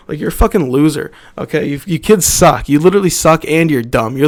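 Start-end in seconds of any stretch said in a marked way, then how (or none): none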